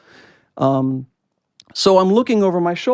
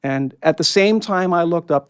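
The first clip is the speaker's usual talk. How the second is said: ordinary talk, nothing marked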